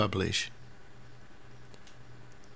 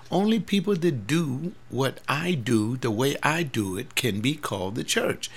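ordinary talk

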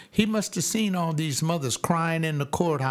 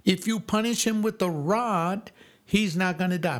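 high, driving preacher's exhortation; preaching; 4 semitones higher than usual. Acted. Preacher urging parents not to hold back, high and driving. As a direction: preach it hard to the congregation.

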